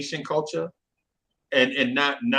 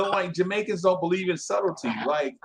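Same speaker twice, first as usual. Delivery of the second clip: ordinary talk, nothing marked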